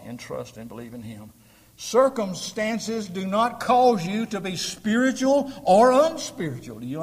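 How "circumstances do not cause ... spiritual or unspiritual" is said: loud, high and slow; booming pulpit rebuke; preaching at full force. Acted. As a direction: hammer the point home.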